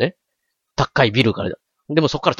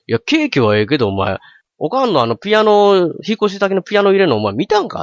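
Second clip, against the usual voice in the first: mock dubbed hero in broad Kansai dialect, rising higher; 関西弁の吹き替え; 6 semitones higher than usual. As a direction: play a Kansai-dialect brother questioning his sister. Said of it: "めっちゃ関西弁なんですよ". (関西弁で妹を問い詰める兄の物真似)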